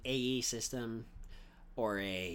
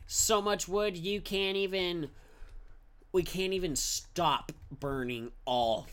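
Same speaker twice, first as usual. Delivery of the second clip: excited; 6 semitones higher, 5 dB louder, slower than usual. Real, not acted. Excited exclaim.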